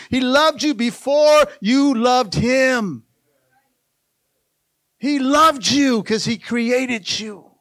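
high, loud, stretched phrase endings; full-force preaching. Real, not acted. Preacher tells church that God loves them, impassioned preaching. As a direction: drive each phrase home to the church.

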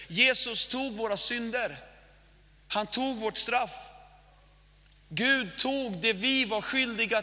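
slow, weighty preaching cadence. Acted. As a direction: preach each line slowly, with weight. Preaching with emphasis. (långsam, tung predikoton)

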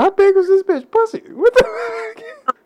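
high incredulous yelp at the maxi pad story; shocked laughing disbelief. Real, not acted. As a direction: yelp it out in disbelief while cracking up.

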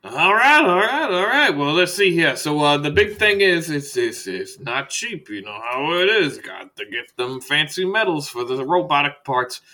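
high, loud start, settling as he explains; hustling doctor easing into the price; chatty salesman doctor. Acted. Doctor explaining costly robotic parts, chatty and breezy.

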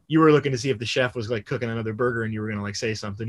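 fast, fading, teasing accusation; rushed teasing. Retold or imitated. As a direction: rattle it off, dropping away. Teasing Brian about the chef.

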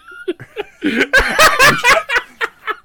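high, loud burst of laughter; real big laugh. Real, not acted. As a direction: crack up completely. Host bursts out laughing at joke.

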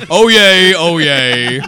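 loud laughing "oh yay"; laughing cheer. Real, not acted.

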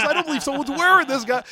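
loud, high-pitched incredulous outburst; freaking out. Retold or imitated. Retelling a wrestler's reaction to the shirt, freaking out. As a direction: imitate him losing it, loud and high. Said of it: "he was freaking out".

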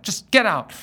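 mock-curt gate agent ordering him out. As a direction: snap it like an annoyed gate agent. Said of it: "She says, just like that".

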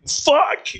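very high retold outburst; mock exasperated yell. Comic retells his set derailed by alarm, exasperated yelp. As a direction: cry it out in comic frustration.

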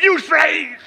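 loud, high, laughing verdict; laughing quote. Retold or imitated. Pastor repeating his daughter's verdict to the church, loud and laughing. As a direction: belt it out through a laugh. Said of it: "My daughter said".

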